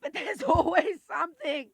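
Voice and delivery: high, loud laughing exasperation; exasperated laugh at her situation; bitter laugh. Real, not acted. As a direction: laugh it out in disbelief.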